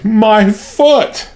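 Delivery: high squealing wail rising at the end; hysterical squeal. A narrator's reading. Maid still squealing about her foot, high hysterical. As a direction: keep squealing in pain. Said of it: "she squealed hysterically".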